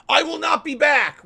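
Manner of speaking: high loud mocking voice, laughing; mocking Scarface. Streamer mocks a chatter's exit line, laughing loudly. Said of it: "Scarface says".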